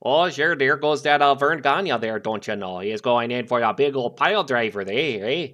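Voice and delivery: mock Minnesota play-by-play with a big "eh"; Minnesota accent. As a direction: call the match like a folksy Minnesota commentator. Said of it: "the commentators and the announcers going like".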